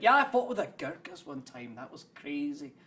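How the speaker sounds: hammed-up Scots-Kiwi storyteller; shopkeeper's put-on accent. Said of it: "was from New Zealand".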